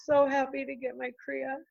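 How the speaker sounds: high, softened voice close to crying; apologetic, real choked-up gratitude; tearful. Real, not acted. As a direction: overcome with grateful emotion. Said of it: "I'm gonna cry thinking about that".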